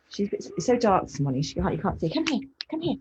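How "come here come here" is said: high-pitched calls to a dog; real high dog-calling voice. Real, not acted. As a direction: coax the dog over in a high, insistent voice.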